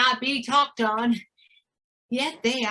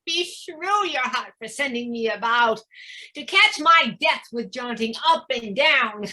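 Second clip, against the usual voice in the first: ordinary talk, nothing marked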